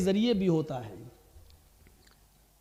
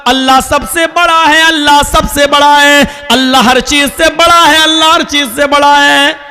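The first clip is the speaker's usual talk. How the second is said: loud, raised, ringing proclamation with held endings; full-force preaching; 8 semitones higher, 16 dB louder than usual. Acted. Preacher summons shopkeepers, farmers and office workers to prayer, full-force proclamation. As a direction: call out like a crier summoning a crowd.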